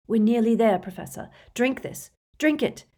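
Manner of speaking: tense, insistent pleading; urgent coaxing. A narrator's reading. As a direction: urge desperately to drink. Harry urging Dumbledore on.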